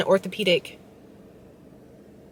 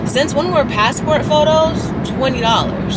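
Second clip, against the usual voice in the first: indignant disbelief; 7 semitones higher than usual. Real, not acted. Outraged at twenty dollar price.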